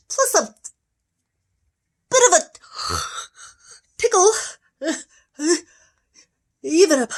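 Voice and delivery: rising high and loud toward a sneeze; building panic. Acted.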